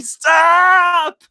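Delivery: high, loud mock scream of stop; hammed-up yell, way up high. Guard yelling at the bulldozer, mock scream. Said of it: "sitting there screaming".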